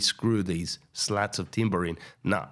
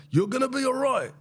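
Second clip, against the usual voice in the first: much higher, put-on voice; dismissive reassurance. Friend brushing off donkey's fear, high put-on voice. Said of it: "He's like".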